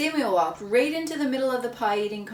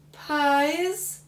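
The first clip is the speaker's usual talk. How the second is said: dismayed question; 6 semitones higher, 4 dB louder than usual. Acted. Samuel dismayed.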